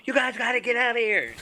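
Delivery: high-pitched hurry-up call; urgent mock yell. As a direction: shoo everyone away in a high voice. Retold urgent order to flee.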